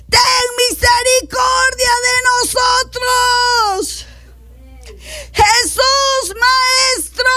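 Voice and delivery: high, long-drawn cry for mercy; acted desperate calling out. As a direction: cry out from afar, stretching every phrase. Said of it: "alzaron la voz diciendo".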